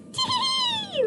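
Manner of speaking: very high mock giggle; squeaky taunting tee-hee. A narrator's reading. Gingerbread man laughing at the goat. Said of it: "laughed the gingerbread man".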